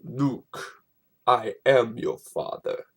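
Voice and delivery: low, slow Vader impression; a lightly voiced Darth Vader; misquoted Vader line. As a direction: growl it like the famous misquote. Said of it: "everybody says this".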